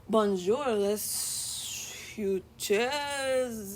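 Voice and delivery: very slow, drawn-out attempt at French; botched French attempt. Shown as a demonstration. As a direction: sound out BONJOUR, LES FUTURS haltingly, stretching the last word. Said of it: "gonna say it super duper wrong".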